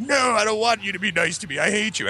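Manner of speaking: high-pitched childish mock tantrum; sulking kid. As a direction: reject dad like a wounded little kid. Hurt kid rejecting dad's kindness.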